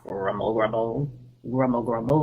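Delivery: deep, soft rumble, grumble, grumble; low grumbling tummy noise. Acted. Reading a picture book to kids, deep soft tummy grumble. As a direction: growl the words like a hungry belly.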